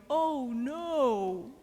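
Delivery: long drawn-out "oh no"; mock dismay. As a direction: catch yourself with a playful, stretched-out groan of dismay. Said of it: "you can go".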